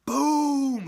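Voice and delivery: very loud, high blast; explosion boom. Retold or imitated. Vlogger voicing the bomb blast, loud shout.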